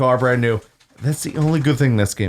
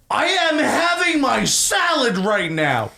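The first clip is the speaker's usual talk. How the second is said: slow, high-pitched mock outrage; imitated yelling; 9 semitones higher, slower than usual. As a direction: draw out each word like an indignant customer, pitched up. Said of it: "He's yelling this at me".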